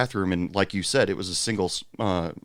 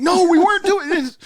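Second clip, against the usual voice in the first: high, loud squeaky denial; mock panicked denial; 8 dB louder than usual. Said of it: "they're like".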